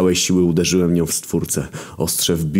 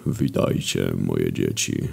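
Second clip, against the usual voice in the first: much lower, softer, grave divine voice; deep voice of God greeting his children; God's voice; 11 semitones lower, 4 dB quieter, slower than usual. A narrator's reading. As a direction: speak as a solemn deity from above. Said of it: "głos Boga".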